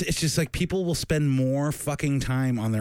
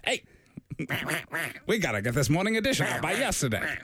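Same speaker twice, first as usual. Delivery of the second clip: 1950s narrator; 3 semitones higher than usual. Pretend. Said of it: "turn into [name]'s old-timey voice".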